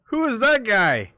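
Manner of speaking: high, loud baffled mock reaction; puzzled listener. Imagined viewer hearing the tinny voice, loud baffled. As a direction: squawk in disbelief at the tinny voice. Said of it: "It's like".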